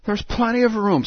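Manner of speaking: high, lightly indignant protest; Alice objecting, high. Acted. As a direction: object like an offended girl.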